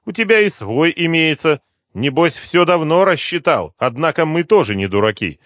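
high, loud sneering voice; mock him from above; smirking general. General sneers at Slepnyov, high and loud. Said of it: "ухмыльнулся генерал".

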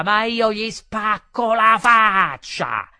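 loud, high, drawn-out bellow; yelling. A narrator's reading. Commendatore threatening the waiter, yelling coarsely. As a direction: yell the threat like a porter. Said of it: "da vero facchino urlava".

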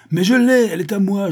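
high, loud possessive outburst; triumphant shout. Acted. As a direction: burst out claiming it as yours. Vorski's furious claim to the stone. (cri aigu de possession)

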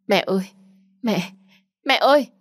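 high, rising cries for mother; desperate calling. Acted. Daughter calling after her vanishing mother in a dream, desperate. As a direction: call out desperately, loudest on the last cry.

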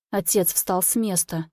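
usual speaking voice

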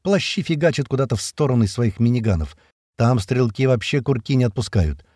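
ordinary delivery, nothing marked

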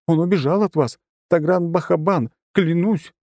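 high, hurried, scared confession; frightened babbling. A narrator's reading. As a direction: blurt it all out in fear. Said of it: "тут же зачастила бледная, как моль".

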